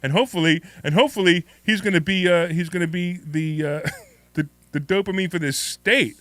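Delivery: loud, gushing excitement; bursting with glee, laughing through it; giddy. Real, not acted. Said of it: "I'm, like, so excited".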